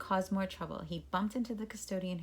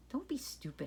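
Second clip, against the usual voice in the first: snapping; 6 semitones higher, 7 dB quieter than usual. Acted. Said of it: "Howie snapped".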